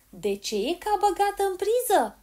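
high, loud puzzled question; old woman loudly mishearing; baffled exclamation. A narrator's reading. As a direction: ask it in loud bewilderment. Deaf granny mishears, asks loudly.

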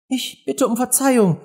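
high, meek little apology; very humble. A narrator's reading. Alice apologises to the scolding mouse, very humbly. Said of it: "sagte Alice sehr bescheiden".

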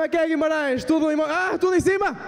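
very high, excited shout to the room; crowd greeting. Real, not acted. Comedian greeting the Guimarães audience, high and excited. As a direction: fire up the crowd. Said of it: "greeting the Guimarães crowd".

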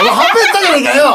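fast, high comic protest; mock-outraged retort. Real, not acted. Host catching a player who changed her answer, mock outrage. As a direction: jump on the lie with laughing outrage.

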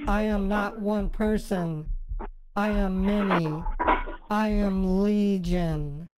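slow, low, ominous demon voice; Captain Howdy. Retold or imitated. Woman retells the voice in her head, ominous. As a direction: intone it slowly, low and measured. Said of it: "And he says".

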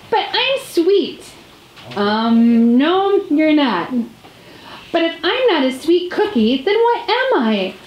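high, dismayed cookie voice; upset cookie. Acted. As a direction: wail that you are not sweet after all. Cookie despairs, about to cry.

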